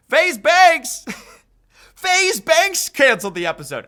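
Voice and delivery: laughing out the name in disbelief; high, loud and laughing, can't believe it. Real, not acted. Vlogger laughs as he tells viewers the news, laughing.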